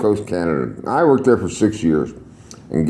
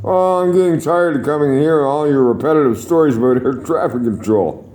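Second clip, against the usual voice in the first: complaining friend; 5 semitones higher than usual. Retold or imitated. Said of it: "he said".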